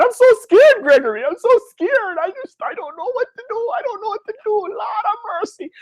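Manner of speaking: hammed-up tearful fright, Jamaican mother; scared Jamaican mom. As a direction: imitate a panicking mother whimpering for mercy. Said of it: "My mom is Jamaican".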